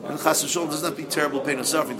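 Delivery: ordinary talk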